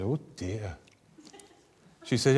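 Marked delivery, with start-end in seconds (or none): 0.0-0.8 soft, low counsellor's "oh dear"; quiet, careful counselling voice; muted sympathy. Retold or imitated. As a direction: murmur a concerned response, low and hushed. Acted counsellor's muted sympathetic reply.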